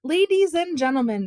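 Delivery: high, loud public address; announcing shout. A narrator's reading. Tom addresses the woken neighbourhood, a high announcing shout. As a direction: hail the whole street like a ringmaster. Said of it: "shouted Tom through the dying whine".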